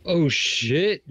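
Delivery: high, very loud shocked outburst; real startled yell. Real, not acted. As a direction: yell out in sudden alarm. Sudden blast in the game.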